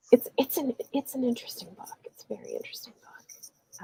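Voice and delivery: whispered musing; voiceless hush. Real, not acted. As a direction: let it fade into a whisper. Trailing whispered comment.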